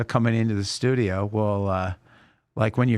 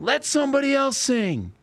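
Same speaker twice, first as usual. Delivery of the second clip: much higher mock yell from the crowd; imitated heckler yell; 14 semitones higher than usual. Bar patron heckling the singers, high mock yell. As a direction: call out like a heckler. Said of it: "somebody yells out".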